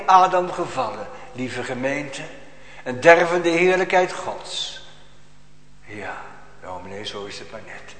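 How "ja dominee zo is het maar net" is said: low, soft, self-satisfied mock agreement; a hint of a pious churchgoer's smug approval. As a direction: mimic a complacent churchgoer nodding along. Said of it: "U kunt zo prachtig zeggen".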